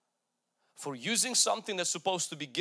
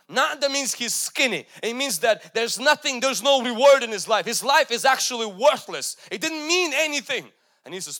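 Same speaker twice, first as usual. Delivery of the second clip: loud, high, forceful preaching; preaching at full force; 4 semitones higher, 9 dB louder than usual. Acted. Preacher thundering the verdict over Belshazzar, loud and high. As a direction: thunder the prophet's verdict like a judgment.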